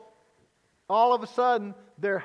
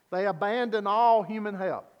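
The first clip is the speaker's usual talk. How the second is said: ordinary talk, nothing marked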